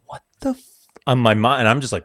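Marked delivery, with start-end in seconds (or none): 0.1-0.9 sudden high-pitched shocked cry; shocked what the. Retold or imitated. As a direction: pitch jumps way up in disbelief. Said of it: "I'm like".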